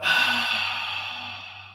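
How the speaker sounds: breathy sighing out-breath; audible exhale sigh. Shown as a demonstration. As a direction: let the breath out as a long sigh. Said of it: "and breathing out".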